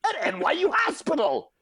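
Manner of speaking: hammed-up furious tirade; retold rant. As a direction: tear into him, outraged. Ranting at deli guy.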